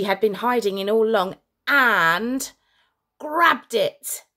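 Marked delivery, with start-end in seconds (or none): none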